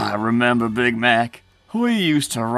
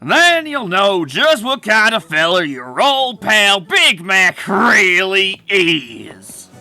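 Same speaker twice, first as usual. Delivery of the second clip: raised, drawn-out bitter jab; pointed accusation; 5 semitones lower, 7 dB louder, slower than usual. Acted. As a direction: build to a slow, loaded finish on the name. Bitter rival hints at betrayal.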